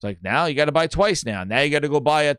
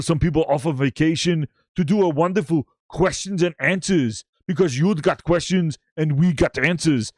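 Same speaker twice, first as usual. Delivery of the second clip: hammed-up Arnold-style accent; [name]. Host mocking Halo's question session announcement, Arnold accent. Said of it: "He talks like Arnold".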